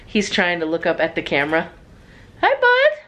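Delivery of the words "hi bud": high-pitched little greeting. Real, not acted. Greeting Harrison looking up at camera, high baby talk. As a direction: coo it high to a little one.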